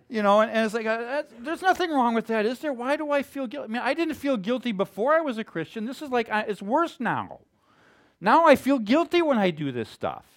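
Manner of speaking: puzzled as if nothing should be wrong; baffled new believer. Retold or imitated. Perplexed convert voiced.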